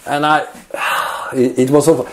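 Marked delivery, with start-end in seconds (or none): 0.7-1.4 voiceless sigh of recalled dread; heavy sigh. Retold or imitated. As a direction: let out a big breathy sigh. Recalls being stuck in crevasse.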